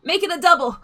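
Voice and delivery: very high, loud, frantic gnome squeal; high, loud panic of a frazzled gnome; dramatic flustered gnome. Acted. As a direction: fling it out like a melodramatic damsel. Gnome orders at bar.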